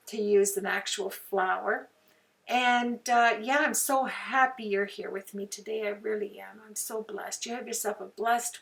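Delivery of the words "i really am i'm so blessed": normal talking voice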